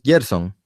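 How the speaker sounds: "Gerson" starting with a Y sound, like "Yerson"; pronounced with a Y sound. Shown as a demonstration. Host checking how the guest says his own name, "Gerson" as "Yerson". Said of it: "veo que lo pronuncias".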